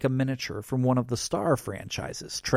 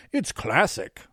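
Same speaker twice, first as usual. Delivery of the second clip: higher, lightly theatrical approval; a hint of the put-on Santa; Santa act; 4 semitones higher than usual. A narrator's reading. Santa judges the first rocket, lightly theatrical.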